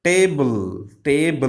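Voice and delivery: "Table" in two beats, tay-bul; teacher splitting "Table" into two syllables. Shown as a demonstration. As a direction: stretch the first half of "Table". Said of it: "Two syllabic words".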